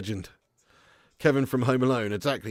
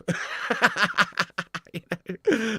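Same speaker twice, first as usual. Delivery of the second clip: long, high-pitched laugh; real laughing fit; 7 semitones higher than usual. Real, not acted. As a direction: crack up laughing. Laughs at chavs called Kev.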